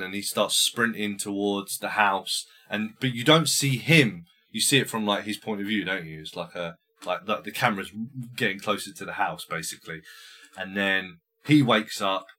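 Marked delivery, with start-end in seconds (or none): none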